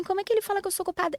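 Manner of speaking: high, rapid indignant retort; indignant disbelief. Retold or imitated. Driver recalling being blamed for the crash, indignant. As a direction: retell it fast and incredulous, pitch jumping up.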